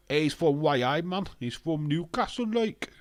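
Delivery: bad Newcastle accent. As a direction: put on a badly faked Newcastle accent. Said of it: "That is worst Newcastle accent ever".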